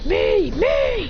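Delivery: very high, eager "me, me"; eager squeal. Acted. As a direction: beg for a turn like a kid. Eager to jump in.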